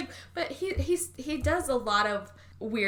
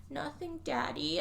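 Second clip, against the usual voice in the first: put-on timid little girl; 6 semitones higher, 8 dB quieter, slower than usual. Cowering girl quoted in retelling.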